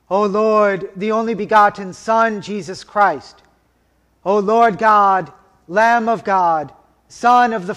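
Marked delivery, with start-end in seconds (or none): none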